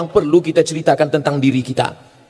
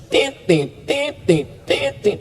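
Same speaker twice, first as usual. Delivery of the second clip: imitating a monitor's broken beeping, tit tit; short clipped mock beeps, tit, tit, tit; 6 semitones higher, 5 dB quieter, much slower than usual. Shown as a demonstration. Preacher to congregation, mimicking hospital monitor short beeps. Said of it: "Koma itu bunyinya belum panjang".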